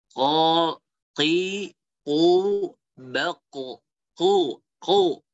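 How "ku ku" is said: ku with the back tongue root raised; deep qaf ku. Shown as a demonstration. Teacher demonstrating deep qaf on ku. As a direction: push ku from deep behind. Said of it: "pangkal itu naik".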